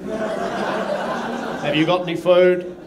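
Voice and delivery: very slow, stretched plea for food; drawn-out begging. Retold or imitated. Comic as customs official, slow pleading for food. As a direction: drag it out like a starving beggar. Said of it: "everyone is there gone".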